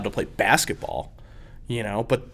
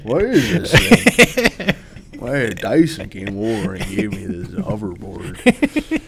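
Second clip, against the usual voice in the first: mock confused player; slower than usual. Mock confused hoverboard owner.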